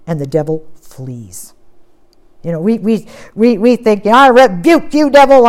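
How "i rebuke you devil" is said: mock loud rebuke. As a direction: imitate someone loudly telling off the devil. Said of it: "and you're going on and on and on".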